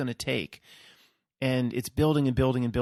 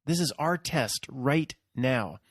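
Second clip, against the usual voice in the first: usual speaking voice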